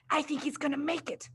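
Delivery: quiet excited whisper. A narrator's reading. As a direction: whisper so nobody hears. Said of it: "whispered Matilda".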